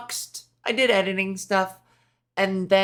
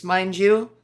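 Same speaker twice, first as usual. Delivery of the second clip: ordinary talk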